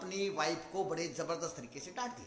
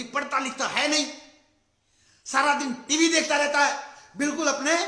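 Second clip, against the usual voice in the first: higher, louder, nagging scold; angry scolding; 5 semitones higher, 8 dB louder than usual. Retold or imitated. Mother scolding her son for watching TV, loud angry nagging. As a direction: scold the child sharply. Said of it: "बोली".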